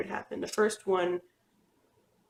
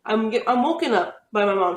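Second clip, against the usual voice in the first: ordinary talk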